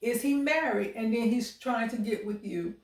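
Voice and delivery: normal talking voice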